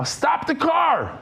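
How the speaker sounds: high, slow shout of panic; frantic yell. Retold or imitated. Friend in the car yelling at the driver to stop, frantic high shout.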